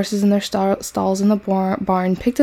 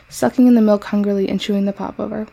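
usual speaking voice

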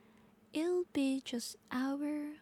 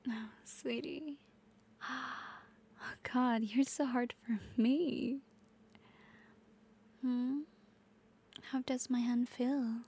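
intimate whisper. Acted. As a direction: whisper it right into the ear. Intimate ASMR roleplay whisper.

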